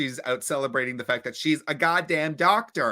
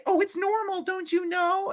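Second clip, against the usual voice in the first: very high, airy mock reassurance; mock sing-song adult. Narrator mocking the movie's explanation, high sing-song. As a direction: parody a breezy movie line. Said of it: "they just say".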